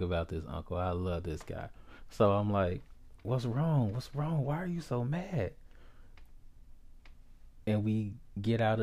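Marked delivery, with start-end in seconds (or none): 3.2-5.5 higher-pitched, rising worried questions; concerned child's pleading. Retold or imitated. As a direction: retell a child pleading with an upset uncle. Worried child asks upset uncle.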